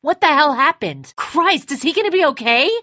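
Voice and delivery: high, loud alarmed cry; frantic shout. Acted. Father panicking over his hurt son, frantic shout.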